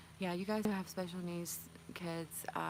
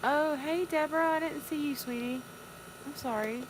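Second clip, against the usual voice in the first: high, loud burst of delight at a viewer; light up and call out hello to a friend; gushing hello, high and loud; bright excited greeting; 9 semitones higher, 4 dB louder than usual. Real, not acted. Greeting a viewer she missed.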